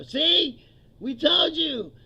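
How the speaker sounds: mock gloating. Comic imitating officials gloating over the testimony. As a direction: rubs it in.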